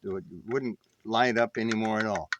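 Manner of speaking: ordinary delivery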